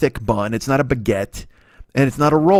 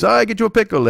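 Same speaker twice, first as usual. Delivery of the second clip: put-on high, eager accented old deli man; high eager voice of the old deli owner pushing a huge sandwich; 9 semitones higher, faster than usual. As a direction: gush like a proud old shopkeeper. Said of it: "he goes".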